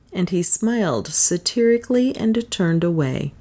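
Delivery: plain reading voice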